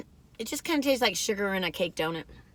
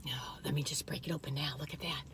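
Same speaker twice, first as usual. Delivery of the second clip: whispering; 7 semitones lower, 15 dB quieter than usual. Real, not acted. Breaking donut open.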